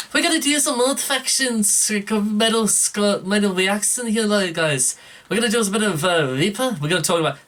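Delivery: hammed-up Scouse accent, pitched well up; badly faked high-pitched Liverpool accent, stumbling along; bad Scouse impression. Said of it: "try and do a Scouse impression".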